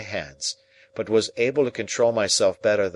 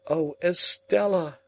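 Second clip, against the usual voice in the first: higher, slow, grieving call; tearful plea; 9 semitones higher, 3 dB louder, slower than usual. A narrator's reading. Pip weeping over Estella's hand, tearful. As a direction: call her name through tears. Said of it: "my bitter tears fell fast".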